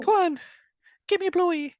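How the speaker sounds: very high, loud squeaky Irish leprechaun; mock Irish leprechaun. Leprechaun flirting with the fortune teller, mock Irish squeak. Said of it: "Kiss me, Clover".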